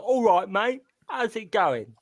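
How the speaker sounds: tossing off a stock Cockney greeting; mock Cockney. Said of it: "Everyone does the same Cockney accent".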